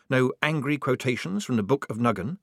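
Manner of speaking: plain reading voice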